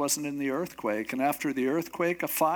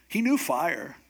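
ordinary delivery

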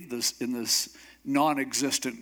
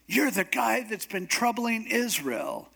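high-pitched accusation as King Ahab; accusing Ahab; 6 semitones higher than usual. Retold or imitated. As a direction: voice a king pointing blame, pitched up. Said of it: "So Ahab comes and says".

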